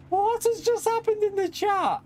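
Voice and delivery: high, stunned disbelief at the chat; shocked squeal. Real, not acted. Streamer sees a huge donation in chat, shocked high squeal.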